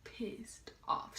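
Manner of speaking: slow, hushed whisper; whispering that mom is furious. Real, not acted. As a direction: drop to a tense whisper. Ominous aside about angry mom.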